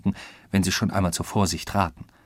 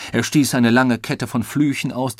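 usual speaking voice

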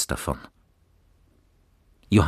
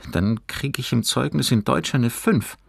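put-on higher boy's voice, mildly glum; gloomy; 9 semitones higher, 3 dB louder than usual. A narrator's reading. Fabian predicts his report card grade, mildly gloomy. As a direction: foretell the bad grade with a touch of doom. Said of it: "sagte Fabian düster".

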